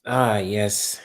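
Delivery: usual speaking voice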